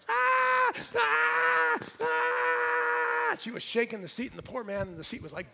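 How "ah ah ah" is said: piercing high screams of a panicked flyer; shrill hammed-up airplane screams; imitated terrified screams. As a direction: scream like you're terrified of flying. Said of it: "screaming the entire flight".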